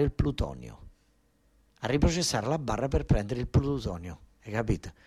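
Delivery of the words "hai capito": normal talking voice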